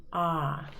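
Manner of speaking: the middle vowel ah alone; just the ah; soft open ah; isolated vowel ah. Shown as a demonstration. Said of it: "what the middle sound is".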